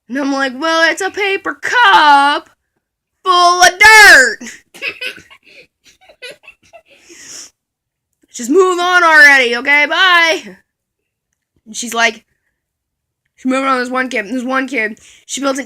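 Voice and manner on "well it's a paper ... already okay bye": loud, slow, laughing till it cracks; breaking up laughing; tearful laughter. Real, not acted. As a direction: barely get it out through laughs. Laughing, crying-laugh explanation.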